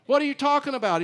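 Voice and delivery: high, loud, bewildered; startled protest. Retold or imitated. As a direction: blurt it out in disbelief. Quarrel at TSA.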